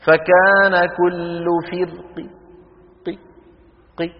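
slow chant, then قي as a brief partial kasra; rawm stop. Shown as a demonstration. Teacher checks students can hear it, rawm stop. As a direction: recite فرق, then isolate قي with a reduced vowel. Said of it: "لأنه الروم هو إيه؟ خفض الصوت".

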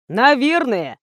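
usual speaking voice

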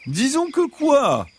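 high, slow fox pressing for an answer; the fox leaning in, pushy but sweet; insistent fox. Acted. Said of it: "insista le renard".

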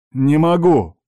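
high, loud cry of refusal; desperate exclamation. A narrator's reading. Uncle loses self-control before niece, exclaiming. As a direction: exclaim it, losing composure briefly. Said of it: "теперь воскликнул мужчина".

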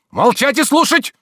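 very high, loud, slow order; commanding shout. A narrator's reading. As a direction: silence her with a shout. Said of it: "крикнул Обломов, так что Анисия оробела". (властный окрик)

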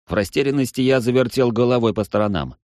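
plain reading voice

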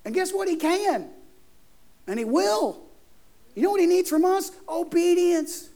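high-pitched, insistent sermon voice; impassioned preaching. Real, not acted. Preacher urging the congregation to obey, impassioned preaching.

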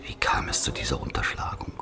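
a hushed whispered question, barely voiced. Narrator whispers the question to the listener, hushed. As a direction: lean in and whisper it like a secret.

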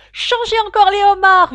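high, all-out bellow; full-force yell. A narrator's reading. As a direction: yell with all your might. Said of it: "hurla le griffon de toutes ses forces". (hurler de toutes ses forces)

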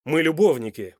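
МЫ ЛЮБОВНИКИ loud and insistent; demanding. A narrator's reading. Man insists on his right to know, demanding. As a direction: assert it forcefully, as a claim on her. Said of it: "требовательно спросил Данте".

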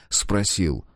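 ordinary delivery

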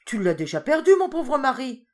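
high, loud mocking retort; wife snapping at her foolish husband, loud and high; scolding wife. A narrator's reading. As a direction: throw his foolishness back at him.

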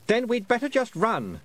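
put-on boy voice. A narrator's reading. Roland voiced in story.

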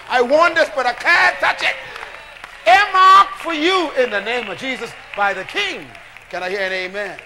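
loud, rising call to the congregation; full-force preaching. Acted. Preacher calling out to his congregation, full-force preaching.

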